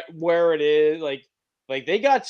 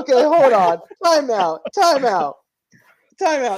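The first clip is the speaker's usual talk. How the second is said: loud time out; 7 semitones higher, 7 dB louder than usual. Real, not acted. Calling time out on friend.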